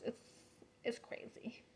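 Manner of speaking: anxious whisper to viewers. Real, not acted. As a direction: breathe it out, drained and anxious.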